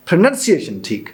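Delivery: normal talking voice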